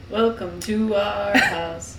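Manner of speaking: slow, stately joke welcome; mock solemn welcome. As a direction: draw it out like a mock-formal host. Co-host jokes as welcoming character.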